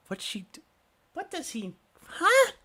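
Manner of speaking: baffled. Acted. Shopkeeper left puzzled by the dragon remark, rising confusion. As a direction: lose the thread entirely. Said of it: "he is very confused".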